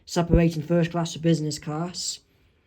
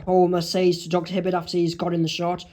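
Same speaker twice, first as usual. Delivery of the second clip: ordinary delivery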